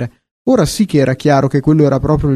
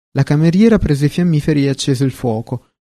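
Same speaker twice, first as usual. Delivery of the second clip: ordinary delivery, nothing marked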